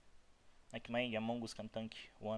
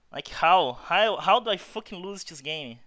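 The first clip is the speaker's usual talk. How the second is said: exasperated rage; 4 semitones higher, 10 dB louder than usual. Real, not acted. Raging at losing.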